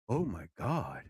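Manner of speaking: ordinary talk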